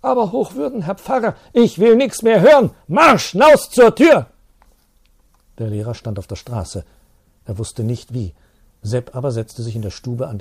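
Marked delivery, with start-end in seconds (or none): 0.0-4.2 high, loud furious tirade, slowing at the end; enraged shouting. Acted. Priest throwing the teacher out, enraged shouting. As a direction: drive him out the door with shouting.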